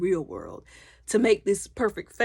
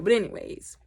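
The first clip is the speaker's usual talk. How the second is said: usual speaking voice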